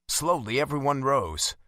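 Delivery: ordinary delivery, nothing marked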